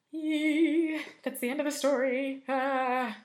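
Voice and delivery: loud, high shiver of relief; spooked yelp. Real, not acted. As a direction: shake off the chill out loud. Shakes off the scary ending.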